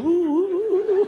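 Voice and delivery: a wife cooing down the phone; high swooning ooh. Retold or imitated. Wife cooing at her husband's call, high and loud. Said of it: "She's going".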